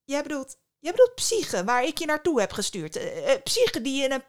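halting start, then high and loud; sputtering, astonished recap; incredulous outburst. A narrator's reading. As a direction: build from puzzled check to loud disbelief. Mother incredulously confronts her son.

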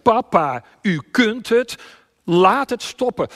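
high, loud desperate prayer; crying out. Acted. Preacher shows the lost how to pray, crying out. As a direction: shout it to God, slowing on the last plea. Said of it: "je schreeuwt het uit".

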